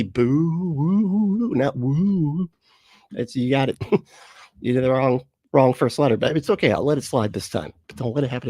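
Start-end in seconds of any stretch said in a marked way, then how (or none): 0.0-2.5 mock ghost woo. Mocking ghost woo message.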